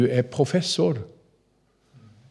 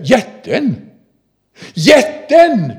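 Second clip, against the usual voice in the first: put-on loud, higher call, less shrill than the girl; father calling out; 10 semitones higher, 7 dB louder, much slower than usual. Father echoing his daughter's cry, loud and high. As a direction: repeat the girl's cry as the father. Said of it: "far säger".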